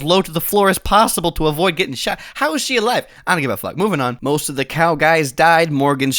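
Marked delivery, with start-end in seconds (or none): none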